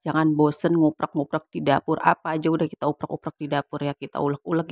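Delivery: ordinary talk, nothing marked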